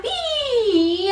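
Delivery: very high, loud, held pee; highest head voice. Shown as a demonstration. Head voice released all the way down, squealing. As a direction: let a squeal slide down. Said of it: "highest head voice".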